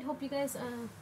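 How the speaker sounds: ordinary delivery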